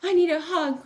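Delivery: high, loud crying plea; crying child. Acted. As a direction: wail it like an upset kangaroo child. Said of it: "cried Jilly".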